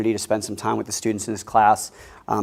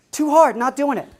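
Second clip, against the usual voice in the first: put-on high, stubborn six-year-old, much higher pitch; defiant child, high; 14 semitones higher, 6 dB louder than usual. Father imitates his daughter refusing the test, high and defiant. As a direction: squeak it out like a defiant little girl. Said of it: "Right to the teacher's face".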